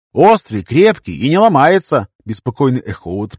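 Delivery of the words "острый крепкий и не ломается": loud, high delighted praise. Acted. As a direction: show off the knife with glee. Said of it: "в восторге тряс".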